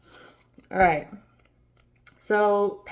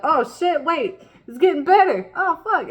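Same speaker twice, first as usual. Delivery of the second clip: high, louder, startled voice; a surprised voice, higher and louder; surprised realization; 7 semitones higher, 6 dB louder than usual. Retold or imitated. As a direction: gasp it out as things suddenly turn. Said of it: "Then you're like".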